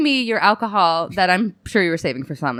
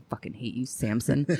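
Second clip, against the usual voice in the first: much softer, low muttered curse; hissed under breath; 8 semitones lower, 10 dB quieter than usual. Retold or imitated. Wife glaring at her husband across the table, muttering quietly. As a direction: say it through your teeth at him. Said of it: "glaring at him across the table".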